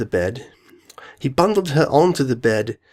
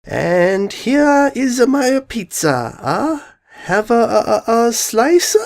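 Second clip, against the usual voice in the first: high, drawn-out character voice; somebody else's voice; 10 semitones higher, much slower than usual. Acted. Pinky offering a slice of pizza, in a high put-on voice. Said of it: "do this in somebody else's voice".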